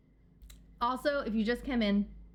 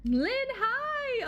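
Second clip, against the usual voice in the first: excited squeal; 13 semitones higher, much slower than usual. Real, not acted. Greeting raider excitedly.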